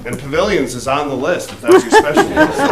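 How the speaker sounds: joking, laughing through the line; laughing while joking. Real, not acted. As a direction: tease them with a laugh. Joking request for more projects.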